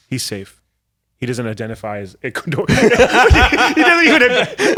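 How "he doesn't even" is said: high, loud laughing disbelief. Real, not acted. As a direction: laugh through the words.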